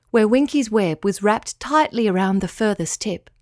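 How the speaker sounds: plain reading voice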